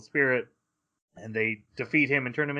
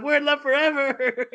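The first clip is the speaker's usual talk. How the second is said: much higher, giddy mock lovey-dovey voice; lovesick couple; 14 semitones higher, 5 dB louder than usual. Parodying the new anime couple for cohosts, mock giddy. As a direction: gush like a smitten teen couple. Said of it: "They're like".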